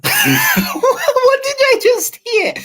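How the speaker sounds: high, laughing squeal of disbelief; drawn-out squeaky shock through laughter; stunned. Retold or imitated. Stunned on hearing the lady's voice, high laughing disbelief. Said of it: "I was stunned".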